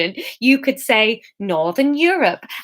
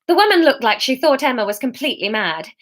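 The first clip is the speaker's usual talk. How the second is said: plain reading voice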